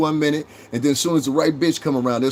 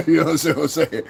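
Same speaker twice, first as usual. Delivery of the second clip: laughing; 4 semitones higher than usual. Real, not acted. Laughing at the chat.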